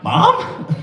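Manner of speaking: high squeaky surprise; hopeful, stunned squeak; surprised mom. Retold or imitated. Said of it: "I was like".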